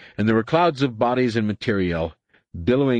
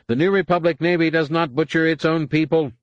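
high, louder nasal shout; 8 semitones higher, 4 dB louder than usual. A narrator's reading. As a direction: shout the order through the nose. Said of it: "Sov's nasal voice was shouting".

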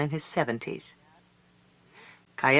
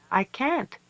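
put-on child voice; 9 semitones higher than usual. A narrator's reading. Child pleads.

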